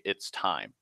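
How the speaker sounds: soft, low, distant "It's time."; disembodied voice. Retold or imitated. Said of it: "that disembodied voice say".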